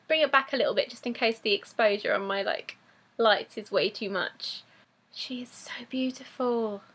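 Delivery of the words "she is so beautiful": soft, breath-only admiration; awed whisper. Real, not acted. Collector admires her new figure, awed whisper. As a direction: whisper it in wonder.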